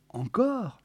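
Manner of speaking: high, questioning disbelief; incredulous question. Acted. Walter hears she wants the film rerun, incredulous. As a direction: query it in dismay.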